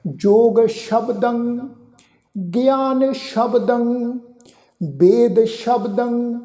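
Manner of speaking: slow, drawn-out scripture recitation; recitation demo. Shown as a demonstration. Teacher shows the congregation the reading, slow careful recitation. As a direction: recite each word slowly, holding the syllables. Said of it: "ਪਾਠ ਉਚਾਰਨ ਕਰਾਂਗੇ".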